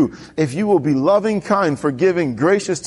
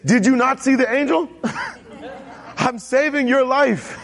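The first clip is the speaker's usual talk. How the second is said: lightly voiced donkey, higher, slow and laughing; a hint of a donkey pleading, chuckling; talking donkey; 7 semitones higher than usual. Preacher voicing the donkey warning Balaam, lightly comic.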